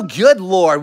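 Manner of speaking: usual speaking voice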